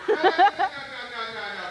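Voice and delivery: ordinary talk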